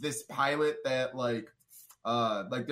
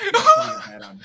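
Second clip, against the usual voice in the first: high and loud, words lost in laughter; cracking up; 12 dB louder than usual. Real, not acted. Covering his bald head, loud high laughter. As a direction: laugh so hard the voice squeaks. Laughing, embarrassed about baldness.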